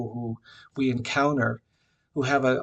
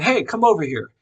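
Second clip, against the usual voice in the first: louder, higher call; 6 semitones higher, 7 dB louder than usual. Retold or imitated. Older woman calling him back, louder. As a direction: call him over insistently.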